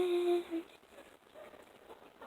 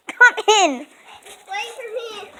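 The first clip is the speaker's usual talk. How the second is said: ordinary delivery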